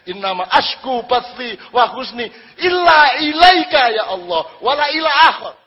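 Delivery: a devotee shouting his love to God; fervent shouted prayer. Acted. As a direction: cry the prayer out with full devotion.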